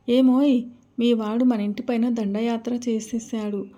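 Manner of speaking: ordinary delivery, nothing marked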